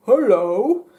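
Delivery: loud, muffled comic penis voice; low muffled voice. Retold or imitated. Man jokingly replies as his penis, low and muffled voice. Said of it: "with a very low and muffled voice".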